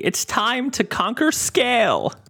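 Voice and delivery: cackling, villainous mad scientist. Acted. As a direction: gloat like a freed supervillain. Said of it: "that crazy hacker himself".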